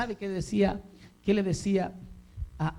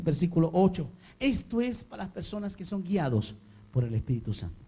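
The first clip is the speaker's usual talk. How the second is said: normal talking voice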